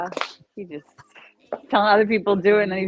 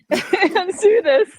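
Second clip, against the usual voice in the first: laughing; 11 semitones higher, 5 dB louder, much slower than usual. Real, not acted. Joking along, laughing.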